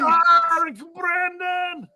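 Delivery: very drawn-out high roar; excited shout. Real, not acted. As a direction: stretch the name in a victory yell. Echoing celebration.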